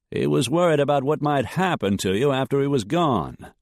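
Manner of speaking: a hint of earnest persuasion; lightly voiced suitor. A narrator's reading. Dietrich persuading Jessica, lightly earnest. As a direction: sound sincere, persuading her.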